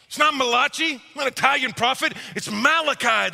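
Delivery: lightly voiced pedantic wife; correcting wife. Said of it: "Don't say it".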